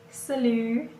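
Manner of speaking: "salut" said "suh-LU", the first vowel a short, weak uh; "salut" with a reduced first vowel, as "suh-lu"; French pronunciation. Shown as a demonstration. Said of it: "not salut".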